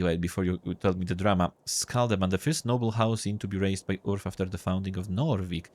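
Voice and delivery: ordinary talk, nothing marked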